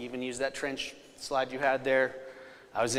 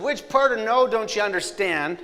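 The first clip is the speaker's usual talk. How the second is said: loud, high-pitched comeback; exasperated retort; 8 semitones higher, 7 dB louder than usual. Retold or imitated. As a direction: throw it back at her, raised voice. Husband snaps back in retold quarrel.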